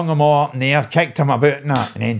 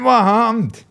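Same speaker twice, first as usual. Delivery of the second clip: surprised; 10 semitones higher than usual. Retold or imitated. Reacting to failed backflip.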